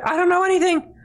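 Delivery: high, tearful mock pleading; mock whiny soldier. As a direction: squeal out a weak denial. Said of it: "they're like".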